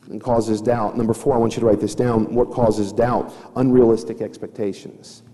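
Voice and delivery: ordinary talk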